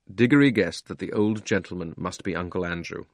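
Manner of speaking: ordinary delivery, nothing marked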